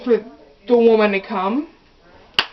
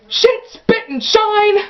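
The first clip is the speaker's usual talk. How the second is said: high, loud shrieked curse; mock scream; 11 semitones higher, 5 dB louder, slower than usual. Mom screaming in the car, high shriek. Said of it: "this is what she screams".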